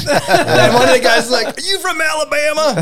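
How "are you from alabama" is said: high shouted question; Southern heckler. Retold or imitated. Bow hunter voiced.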